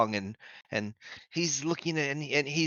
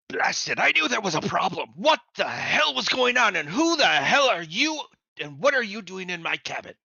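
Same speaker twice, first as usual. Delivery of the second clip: angry shout; 6 semitones higher, 4 dB louder than usual. Acted. NPC startled by intruders in cabin.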